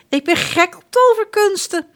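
put-on high, loud childish enthusiasm; delighted. A narrator's reading. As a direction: squeal it like a spoiled child. Prince delighted by magic.

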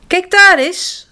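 high, loud and slow alarmed cry; startled call. A narrator's reading. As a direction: call out in shock at what she sees. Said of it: "roept Spruitje de volgende ochtend geschrokken". (geschrokken roepen)

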